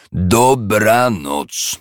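very slow pointed goodnight; drawn-out curt goodnight. A narrator's reading. Scrooge dismissing his nephew with a very slow goodnight. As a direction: stretch it out to end the talk. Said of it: "powtórzył Scrooge".

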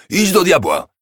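high loud outburst; heated shout. A narrator's reading. Scrooge flaring up at his nephew, shouting. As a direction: fling the words out in a temper. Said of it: "zawołał z uniesieniem".

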